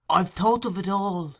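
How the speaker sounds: bursting with eager scheming; old fence eager to reassure Sikes; with energy. A narrator's reading.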